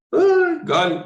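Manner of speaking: high-pitched mock wail, then "gone"; mock dismay. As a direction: imitate a brother's high cry of dismay. Acts out brother's dismayed exclamation.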